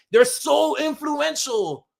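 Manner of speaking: high, slow, drawn-out mock gushing; mocking imitation. Streamer showing trap fans how they sound, mocking. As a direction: stretch it out high to mimic a silly fan. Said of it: "This is how you sound".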